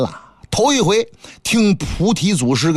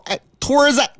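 higher quick answer to a summons; a hint of the monkey disciple; 8 semitones higher, faster than usual. Wukong answering the master's call, lightly higher voice. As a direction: pop up eagerly at your name.

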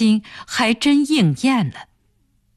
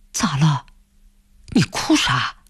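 low, startled asking; taken aback, pitched down; startled question; 5 semitones lower than usual. A narrator's reading. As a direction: ask in sudden concern, voice dropped. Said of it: "他不由得一惊".

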